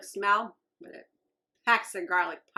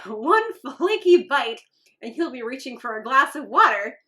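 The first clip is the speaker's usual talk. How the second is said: upbeat, bright ad-copy read; box copy read-out; 4 semitones higher, 6 dB louder than usual. Acted. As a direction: read the package like a peppy ad. Reads the snack box text.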